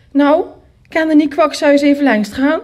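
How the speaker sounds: loud, high-pitched order; commanding. A narrator's reading. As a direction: order the child off on an errand. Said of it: "commandeerde ze mij richting tante Jane". (luid commanderend)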